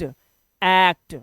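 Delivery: "एक्ट" very slow, long ae vowel; "एक्ट" as a lengthened ऐ...क्ट; drawn-out act. Shown as a demonstration. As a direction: stretch the first vowel of "एक्ट" like cat. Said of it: "इसे पढ़ेंगे एक्ट".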